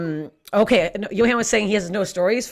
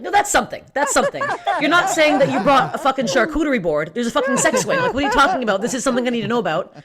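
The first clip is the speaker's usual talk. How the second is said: higher-pitched, incredulous retold protest; mock indignant; 6 semitones higher, faster than usual. Comedian retells confronting her date about the surprise, mock indignant. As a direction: confront him with exasperated disbelief. Acted argument over the sex swing.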